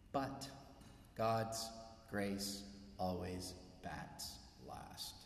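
very slow, soft closing line; slow hushed landing. Acted. Pastor closing his point, slow and soft. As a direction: let the last line settle. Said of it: "closing line, slowed".

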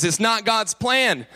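ordinary talk, nothing marked

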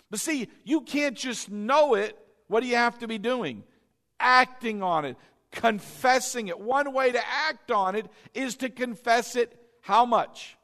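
ordinary talk, nothing marked

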